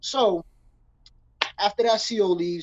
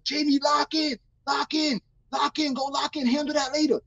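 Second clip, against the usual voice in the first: high, repeated mock holler at usual volume; imitated yelling; 5 semitones higher than usual. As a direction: call out high across the cells. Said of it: "Everybody's yelling at him".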